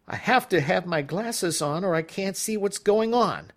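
high petulant protest; whiny complaint. Acted. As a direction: complain like a spoiled old man.

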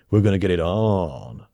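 drawn-out lecherous anticipation; smug self-seduction. A narrator's reading. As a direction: psych yourself up for seduction. Preening at his mirror reflection.

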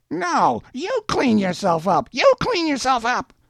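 very high put-on heckler voice; yelling back. A narrator's reading. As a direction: fling the insult back in a high mock yell. Shouting match between street and window.

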